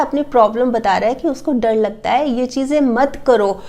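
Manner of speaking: usual speaking voice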